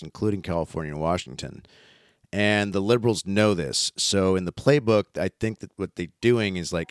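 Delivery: ordinary talk, nothing marked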